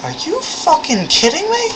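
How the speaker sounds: very high, disbelieving squeal of a question; mock incredulous. Reacting to the kid's ridiculous question, mock incredulous. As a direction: pitch way up in exaggerated disbelief.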